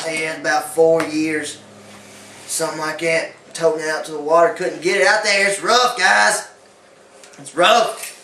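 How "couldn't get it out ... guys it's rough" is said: mock anguish. Castaway's hammed-up lament.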